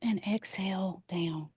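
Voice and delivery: near-whisper, let out on the breath; hushed exhale cue. Real, not acted. Leading a slow exhale.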